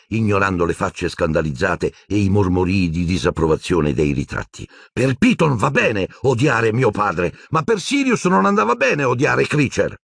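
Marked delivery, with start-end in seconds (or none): 4.9-10.0 angry shout. A narrator's reading. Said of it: "Urlò Harry".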